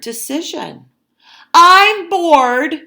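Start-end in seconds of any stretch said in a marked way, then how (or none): 1.5-2.8 high, loud declaration to the room; bored announcement. Acted. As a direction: announce it loudly over everyone. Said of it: "she announced".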